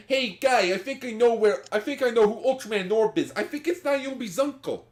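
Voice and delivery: high, loud Brooklyn dub voice; hammy Brooklyn wise guy; Brooklyn accent. Pretend. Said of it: "dub being like Joey from Brooklyn".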